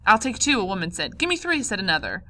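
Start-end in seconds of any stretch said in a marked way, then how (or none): 0.1-0.6 loud, higher-pitched demand; shouting customer. A narrator's reading. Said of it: "shouting and grabbing at her things". 1.2-1.6 high-pitched, urgent demand; shouting customer. A narrator's reading. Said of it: "shouting and grabbing at her things".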